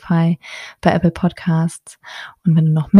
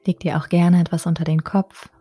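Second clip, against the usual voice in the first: unhurried, measured phrases, each left to settle; guided meditation. Acted. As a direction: calmly guide a resting mother-to-be into relaxation. Leads a Yoga Nidra meditation.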